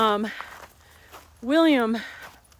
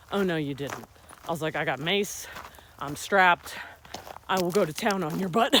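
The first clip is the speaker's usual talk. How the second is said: sassy comeback; 6 semitones lower than usual. Retold or imitated.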